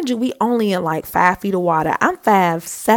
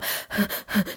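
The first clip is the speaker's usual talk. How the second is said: short, quick puffs of breath; panicked breathing; 7 dB quieter than usual. Shown as a demonstration. Swimmer trying hard to relax, imitated panicky breaths. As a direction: mimic anxious huffing. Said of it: "I was kinda breathing like this".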